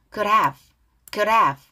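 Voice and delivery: "could have" as one unit, "coulda-v"; weak linked D. Shown as a demonstration. Teacher drills the phrase for learners, D weakened and linked. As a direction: flick the D of "could" lightly into "have". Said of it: "could 할 때 드 발음 약해".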